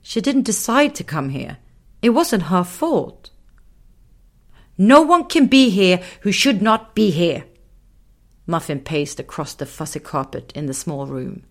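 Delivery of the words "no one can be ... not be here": louder little man stating the rule; stern little man. Acted. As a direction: lay down the law. Muffin declares rule.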